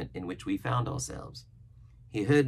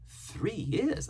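astonished question; 12 semitones higher than usual. A narrator's reading. Boy reacting to the castaway's three years, astonished. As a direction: pitch leaping up on the question.

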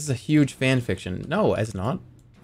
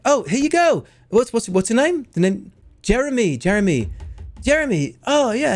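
much higher, flustered fan; nervous excited fan; 9 semitones higher than usual. Pretend. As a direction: stammer like a starstruck fan. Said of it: "being like".

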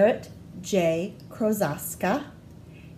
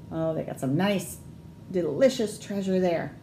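ordinary delivery

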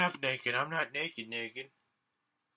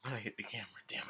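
whispered aside to self; whispering; 7 dB quieter, much faster than usual. Real, not acted. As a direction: hush it, annoyed. Quiet frustrated aside.

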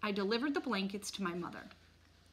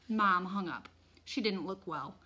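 plain reading voice

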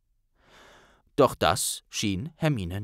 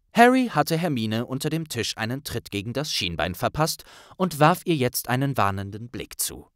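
ordinary delivery, nothing marked

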